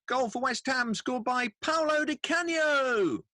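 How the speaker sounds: high, slow, drawn-out goal announcement; stretched-out PA voice calling the scorer; stadium announcer. Shown as a demonstration. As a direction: boom it over the stadium tannoy. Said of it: "the bloke in the background that after the goal's been scored says".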